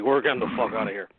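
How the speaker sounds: low gruff buffalo voice. Pretend. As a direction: talk like a fleeing buffalo. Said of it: "why it's a buffalo voice".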